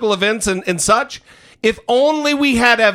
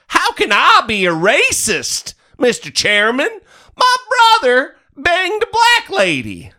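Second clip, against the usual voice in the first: mock hillbilly whine; 11 semitones higher than usual. Mocking Meadows' defense.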